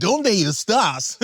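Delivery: slow, high surfer-dude Spanish; California surfer Spanish. Retold or imitated. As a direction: drawl Dónde estás like a California surfer. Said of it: "he was sound like a California surfer".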